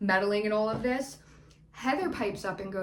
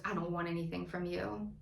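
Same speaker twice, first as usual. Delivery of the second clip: low retold retort; 4 semitones lower, 11 dB quieter, faster than usual. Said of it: "to which Tamara goes".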